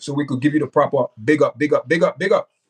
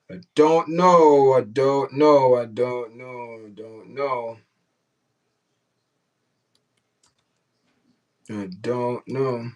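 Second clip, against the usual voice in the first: trailing shrug; 2 semitones lower, much slower than usual. Real, not acted. Streamer puzzled that Jamaican viewers skip the chat, fading drawn-out shrug.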